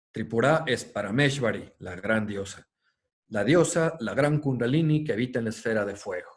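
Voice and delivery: reverent reading of a sacred passage; solemn text reading. Acted. Lecturer reads a quoted hymn to Tripura to students, solemn reading. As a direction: read it out like scripture, with weight.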